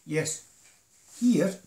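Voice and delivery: usual speaking voice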